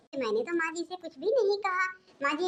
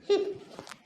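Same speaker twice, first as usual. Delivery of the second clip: snicker; 5 semitones higher than usual. Acted. Sneering sister-in-law aside.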